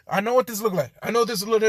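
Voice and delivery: high, loud frantic protest; panicked explaining. Acted. Izuku caught out by Jean, loud panicked explanation.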